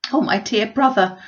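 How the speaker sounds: high, loud, eager cry; Mrs Bennet gushing. Acted. Mother answering her brother, high and eager. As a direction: gush with relief.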